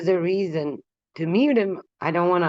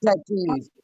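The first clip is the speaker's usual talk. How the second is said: normal talking voice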